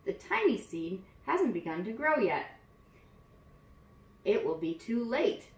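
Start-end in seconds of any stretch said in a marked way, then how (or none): none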